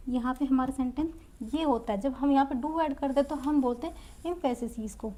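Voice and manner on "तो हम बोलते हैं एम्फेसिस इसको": ordinary talk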